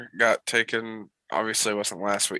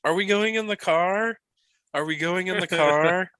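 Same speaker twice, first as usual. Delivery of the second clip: imitating a toddler, much higher and louder, asking over and over; eager toddler voice; 9 semitones higher, 4 dB louder than usual. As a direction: toddler pestering about the car ride, bouncing with eagerness.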